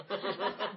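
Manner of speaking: soft wordless puff of breath; breath sound. Shown as a demonstration. Preacher letting listeners hear God's name as breath, soft exhalation. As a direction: breathe out audibly, with no voice. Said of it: "So if you would hear".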